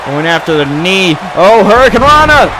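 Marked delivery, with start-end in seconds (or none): 1.3-2.5 excited shout. Real, not acted. Move called in live commentary.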